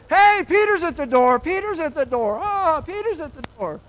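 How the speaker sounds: loud, high, excited calling out; a girl bursting out with the news; excited announcing. Retold or imitated. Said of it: "no, instead she says".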